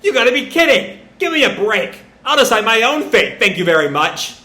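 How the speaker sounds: mock yell, high and loud; hammed-up yell of defiance at the sky; yelling into the void. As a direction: shout defiantly at nobody. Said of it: "Boruto just yells into the void".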